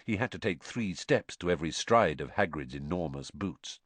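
usual speaking voice

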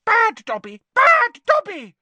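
loud, self-punishing squeal; Dobby. Acted. House-elf banging his head in self-punishment, loud squeal.

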